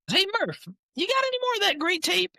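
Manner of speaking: very high comic voice calling out; Mangino impression. Coach asking trainer Murph for tape, high mock voice. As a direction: shout for the trainer in a high, put-on voice. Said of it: "Your Mangino impression is hilarious".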